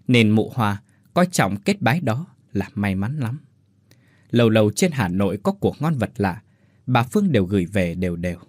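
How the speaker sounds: ordinary delivery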